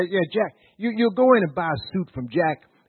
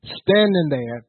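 full-force pulpit preaching; fiery preaching; 2 semitones higher, 6 dB louder than usual. Acted. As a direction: drive it home to the congregation.

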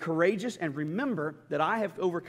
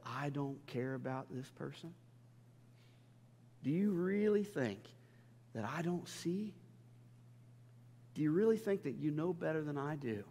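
voice of the Lord; 8 dB quieter than usual. Acted. Preacher voicing God soothing him in prayer, soft and tender. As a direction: hush it down like a loving father calming his child. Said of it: "hear the voice of the Lord saying".

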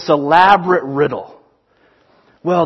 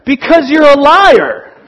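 higher, louder, slow exasperated snap; annoyed retort; 8 semitones higher, 8 dB louder than usual. Retold or imitated. Paul answering the student, annoyed and loud. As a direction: bite back with open annoyance. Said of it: "only slightly less annoyed".

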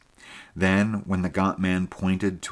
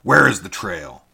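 a louder, frantic demand; loud angry cry; 8 dB louder than usual. A narrator's reading. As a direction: shout the question in rage. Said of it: "he cried".

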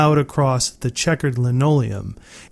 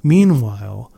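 ordinary delivery

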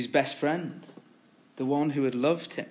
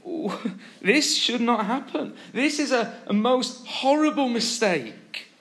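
aghast, acted out in a high voice; acted dismay; 8 semitones higher than usual. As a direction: think aloud in dismay, voice lifted high. Imagining Peter's horrified thoughts.

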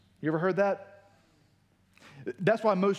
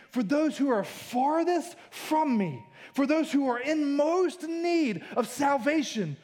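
higher-pitched, rousing proclamation; impassioned preaching; 7 semitones higher than usual. Acted. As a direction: proclaim it phrase by phrase, building in a raised pitch.

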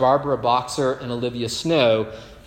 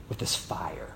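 dramatic whisper; 8 semitones lower, 12 dB quieter than usual. Acted. Dramatic moment of angel's fire.

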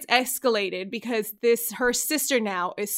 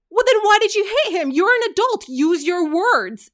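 higher, louder retold scolding; indignant confrontation; 9 semitones higher, 5 dB louder than usual. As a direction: re-enact telling someone off, pitched up and indignant. Acted confrontation, yelling at the woman.

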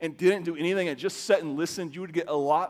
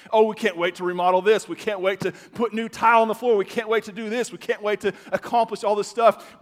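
eager daydreaming; 6 semitones higher, 7 dB louder than usual. Retold or imitated. Mock couple dreaming of remodeling.

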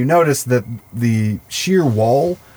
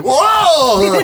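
startled high whoa; crew whoa; 4 dB louder than usual. Retold or imitated. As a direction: whoa at the top of the voice. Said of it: "everybody is like".

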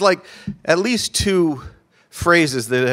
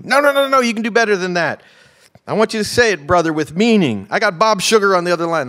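high, loud, excited pushing; hyped-up demanding; 9 semitones higher, 6 dB louder, faster than usual. Retold or imitated. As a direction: goad your agent in a high, worked-up voice. Acted athlete pushing agent for more.

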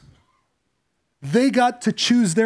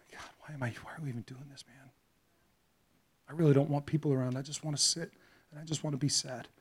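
much softer, low inner lament; despairing father; 6 semitones lower, 14 dB quieter, faster than usual. Acted. Father walking in grief, quietly despairing.

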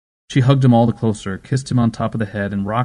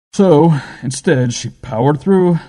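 slow, louder and higher; slow emphatic; 6 semitones higher, 5 dB louder, slower than usual. A narrator's reading. Adams explains the mother's death, slow emphatic. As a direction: drag it out for weight. Adams stresses bitter point.

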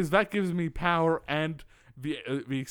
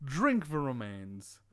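ordinary delivery

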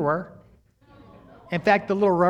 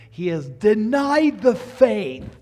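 1 emphatic preaching; 5 semitones higher, 8 dB louder, much slower than usual. Real, not acted. Preacher emphasizing scripture verdict.

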